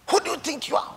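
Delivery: high-pitched indignant mock shout; imitated shouting. Businessman shouting at the preacher in a public place, high and indignant. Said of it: "He started shouting".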